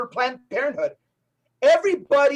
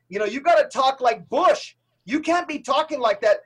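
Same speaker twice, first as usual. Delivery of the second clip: mock protest; 2 semitones higher than usual. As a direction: call foul like an outraged critic, hammed-up. Mimics critics crying foul at Trump.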